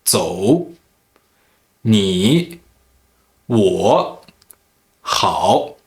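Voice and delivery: 走, 你, 我, 好 each dipping low, then rising; third tone. Shown as a demonstration. Teacher showing learners 走, 你, 我, 好 falling low then rising. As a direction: dip down and back up on 走, 你, 我, 好. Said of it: "pronounced in the third tone".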